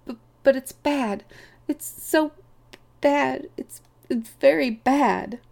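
high, halting, stammering distress; wretched, broken-up high voice; miserable. A narrator's reading. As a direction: struggle to get the dread out, word by word. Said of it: "he concluded miserably".

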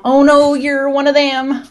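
badly faked American, stretched at the end; horrible American accent. Villain pretending to be a hostage, hammed-up American accent. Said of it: "he does that horrible American accent".

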